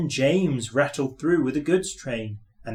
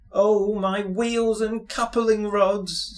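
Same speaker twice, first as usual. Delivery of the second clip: slow, high, sorrowful little engine; a mournful sigh of a voice; sad; 7 semitones higher, slower than usual. A narrator's reading. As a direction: lament it slowly. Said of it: "said Thomas sadly".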